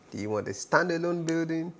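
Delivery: normal talking voice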